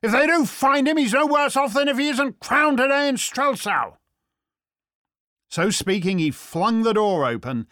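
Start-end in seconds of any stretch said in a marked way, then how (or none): 0.0-4.0 loud, high-pitched angry outburst; roaring. A narrator's reading. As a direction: roar back in exasperation. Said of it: "he roared".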